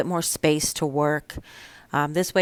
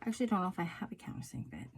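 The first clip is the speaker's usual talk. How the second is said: fast, unvoiced private whisper; real whisper, thinking aloud; whispering to herself; 3 semitones higher, 20 dB quieter, much faster than usual. Real, not acted. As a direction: rush it out under your breath. Whispering to herself while working.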